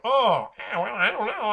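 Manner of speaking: high, wavering reluctance; nervous hesitation. Acted. Players faced with a giant orc, nervous hesitation. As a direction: squirm out of it, unsure.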